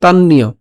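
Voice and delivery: "তান্নীয়" joined, doubled n into "iyo"; said as one word. Shown as a demonstration. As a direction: run "তান্নীয়" together as tan-niyo. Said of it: "একসাথে উচ্চারণ করি যদি".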